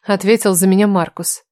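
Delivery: usual speaking voice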